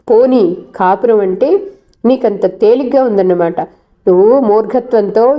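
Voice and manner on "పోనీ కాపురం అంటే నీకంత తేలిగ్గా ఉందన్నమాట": ordinary delivery, nothing marked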